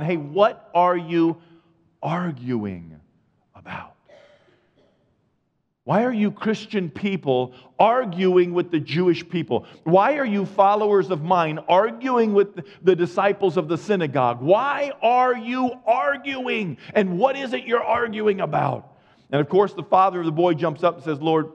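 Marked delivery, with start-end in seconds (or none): 0.0-3.9 confronting, then fading. Acted. Jesus confronts arguing disciples. 5.8-19.0 rising pitch with each repeated question; incredulous, escalating confrontation; exasperated rebuke, building. Acted. Jesus scolds his followers, building.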